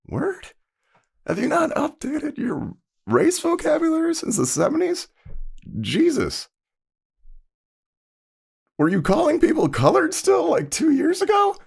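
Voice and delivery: very high-pitched exasperated questioning; incredulous. Retold or imitated. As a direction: squeak it out in mock disbelief. Mocking disbelief at the outdated term.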